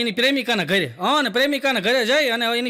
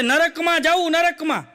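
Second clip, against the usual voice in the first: fed-up yell; 7 semitones higher, slower than usual. Retold or imitated. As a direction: blurt it out, sick of being asked. Fed-up old woman snaps back.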